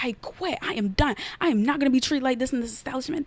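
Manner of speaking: high, indignant quitting speech, replayed; going off. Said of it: "Like, I'm just going off".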